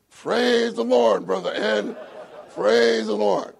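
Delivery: booming, stretched praise through the wall; loud calling out. Retold or imitated. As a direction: call it out slowly and loud like pounding on the wall at one in the morning. Dick pounding on wall at night.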